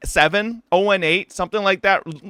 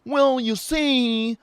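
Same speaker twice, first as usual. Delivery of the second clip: mocking rioter voice; 4 semitones higher than usual. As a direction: play the preachy Riot employee lecturing you. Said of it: "And the rioter's like".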